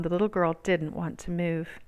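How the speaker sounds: plain reading voice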